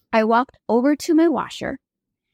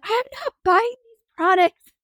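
panicked, high and slow, insisting to the bank; near-shrieking denial, drawn out; frantic high protest; 12 semitones higher, slower than usual. Retold or imitated. On the phone to bank reps, a high, slow, frantic protest.

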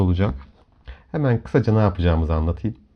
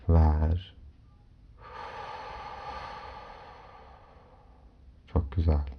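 soft spa whisper; 3 semitones lower, 4 dB quieter than usual. Acted. Leads a guided relaxation. (yumuşak rehberli meditasyon sesi)

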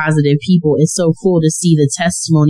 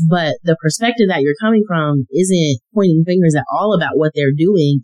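usual speaking voice

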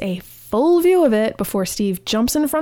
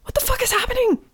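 squeaky, much higher voice of shocked disbelief; replaying a panicked thought in a squeak; stunned disbelief; faster than usual. First-time viewer recalling own shock, high squeaky disbelief. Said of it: "several repetitions of".

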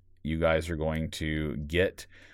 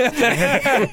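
burst of laughter; 8 dB louder than usual. Real, not acted. Laughing about crying while writing.

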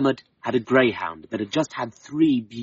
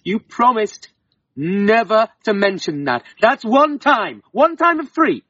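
a wife's stern reckoning, louder; stern fairy woman's warning; 7 semitones higher, 4 dB louder than usual. Acted. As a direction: count his broken promises sternly. Wife warns Dermot.

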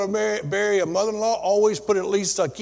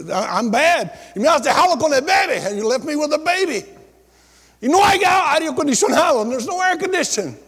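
retold angry yelling; 6 semitones higher than usual. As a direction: replay the wife screaming at him. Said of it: "ella empezó a gritar".

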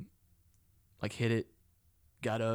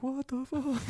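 startled outburst; 14 semitones higher, slower than usual. Retold or imitated. Retelling seeing creatures in the corner, high shocked cry. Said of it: "And I was like".

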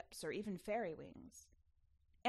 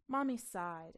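ordinary delivery, nothing marked

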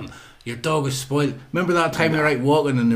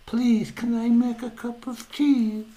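high, slow, mock-meek plea; mock timid asking; 11 semitones higher, slower than usual. Mimicking overly careful house guest asking for tea, mock timid. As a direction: ask as if walking on eggshells. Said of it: "walk about in eggshells".

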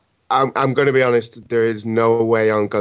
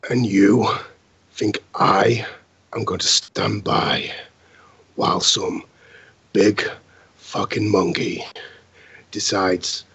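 cool hero voice; 4 semitones lower than usual. Acted. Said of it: "in a bit of a cooler way".

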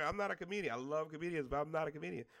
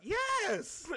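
high, drawn-out exclamation; gleeful high-pitched affirmation; emphatic proud yes; 12 semitones higher, 4 dB louder than usual. Acted.

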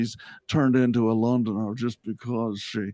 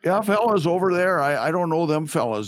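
higher, louder mock Minnesota accent; 5 semitones higher, 3 dB louder than usual. Host mocking TJ as Minnesota guy, mock Minnesota accent. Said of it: "you wanna keep the Minnesota accent".

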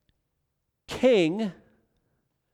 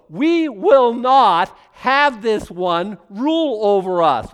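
slow, louder defiant declaration; 5 semitones higher, 9 dB louder, much slower than usual. Acted. The nations refusing God's rule, loud and slow. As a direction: spit it out slowly like a rebel refusing a king.

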